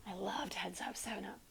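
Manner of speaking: soft playful whisper. Real, not acted. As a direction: whisper it like a secret. Playful aside during class.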